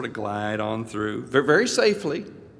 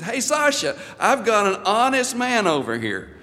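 amused call-out; 10 semitones higher, 6 dB louder than usual. Retold or imitated. Officer amused, calls to partner.

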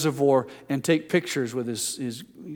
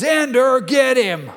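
loud, much higher shout; playful call; 9 semitones higher, 7 dB louder, slower than usual. Retold or imitated. Dad calling to older son during play, excited shout. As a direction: call out gleefully to the brother. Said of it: "and then he says".

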